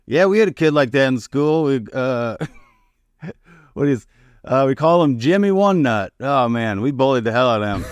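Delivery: tossing it off like old news, mildly mocking; lightly voiced uncle. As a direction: uncle barges in with a careless school story. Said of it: "he goes".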